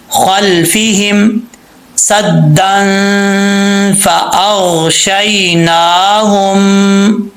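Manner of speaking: measured chant, phrase by phrase with pauses; slow Quran recitation. Shown as a demonstration. Teacher leading a verse for the pupil to recite along, slow chant. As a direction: recite slowly for the student to repeat. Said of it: "میرے ساتھ پڑھنا ہے".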